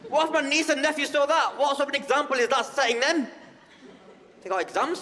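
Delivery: high, loud, shrill-free outraged pearl-clutching voice; outraged bigot. Retold or imitated.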